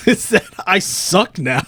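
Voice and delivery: laughing. Real, not acted. Laughing at his own bad play.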